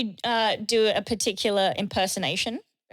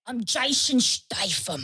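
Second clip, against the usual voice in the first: Jason Statham impression; slower than usual. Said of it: "I can do Jason Statham".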